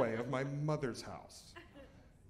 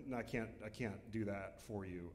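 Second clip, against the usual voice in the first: quiet refusal; 7 semitones lower, 9 dB quieter than usual. Retold or imitated. Teller refusing, soft and low.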